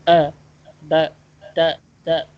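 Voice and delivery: "a, ba, ta, ta" cut short, each syllable stopped abruptly; choppy wrong way. Shown as a demonstration. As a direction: chop "ba, ta" short with sudden pauses. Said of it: "This is not".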